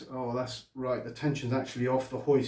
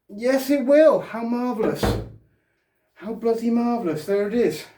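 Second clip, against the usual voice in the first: thrilled, high and loud; excited; 9 semitones higher, 6 dB louder than usual. Real, not acted. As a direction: cheer it as it finally fits. Part finally goes into place.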